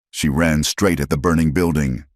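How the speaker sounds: ordinary delivery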